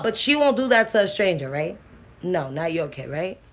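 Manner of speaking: ordinary talk, nothing marked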